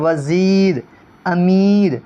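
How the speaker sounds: وزیر، امیر with a long, drawn ee; stretched long ee. Shown as a demonstration. Teacher demonstrates ya maroof, stretched ee vowel. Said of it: "جو یا کھینچ کر پڑھی جائے".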